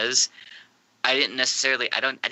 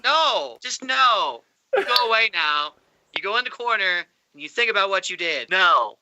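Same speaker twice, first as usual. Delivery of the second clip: loud, high, exasperated telling-off; mock scolding; 8 semitones higher, 4 dB louder than usual. Podcaster banishing the character to the corner, mock scolding. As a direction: scold like a parent sending a child away.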